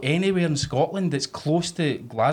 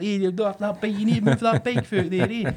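put-on high-pitched Glasgow uni accent, sustained; Limmy's Glasgow uni accent; 6 semitones higher than usual. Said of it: "the Glasgow uni accent".

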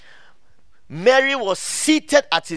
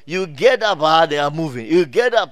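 usual speaking voice

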